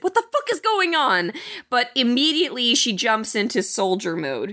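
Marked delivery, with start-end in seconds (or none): 0.0-1.3 high frantic mock yell; mock panic. Retelling Sarah's shock to the co-host, high mock panic. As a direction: squeal it like a startled mother. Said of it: "She goes".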